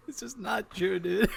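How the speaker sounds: high, through laughter; amused objection. Real, not acted. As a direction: protest while laughing. Laughing at friend's retold claim.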